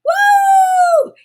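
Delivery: very high loud shout of joy; shrieking cheer of excitement; excited woo. Real, not acted. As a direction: scream it out with delight. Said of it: "And I'm so excited".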